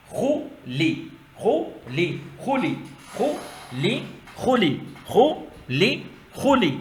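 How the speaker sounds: measured Quran-primer chant, syllable by syllable; syllable drill. Shown as a demonstration. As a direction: recite each syllable slowly for pupils to repeat. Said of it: "خو پڑھتے ہیں اس کو". (قاعدے کے انداز میں ٹھہر ٹھہر کر پڑھائی)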